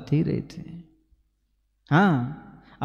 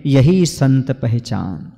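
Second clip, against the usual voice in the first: slow, ringing recited verse; measured poetic cadence, vowels held long; verse recitation; 4 dB louder, much slower than usual. Acted. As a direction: declaim the couplet with drawn-out words.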